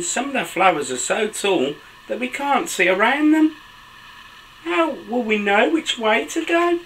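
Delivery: put-on animated children's story reading, high excited exclamations; picture-book narration. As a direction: read to little ones, pitch leaping between narration and exclamations. Reads picture book aloud to children.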